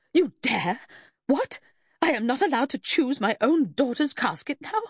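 high-pitched outraged challenge; bursting rage. A narrator's reading. Mother denied choice of her daughter's casket, enraged. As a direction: erupt in affronted disbelief, pitched high. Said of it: "a rage burst out of her".

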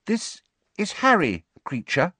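high, careful introduction; tentative. A narrator's reading. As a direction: offer it tentatively in a high girl's voice. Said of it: "said Hermione tentatively".

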